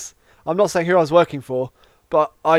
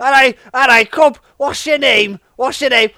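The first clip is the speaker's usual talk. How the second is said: mock Scouse, pitched up; quick Scouse greeting, asking his name; Scouse accent; 6 semitones higher than usual. Stripper greeting Grax, mock Scouse accent. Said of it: "That's Scouse".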